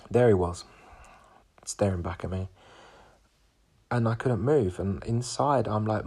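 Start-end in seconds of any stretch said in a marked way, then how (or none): none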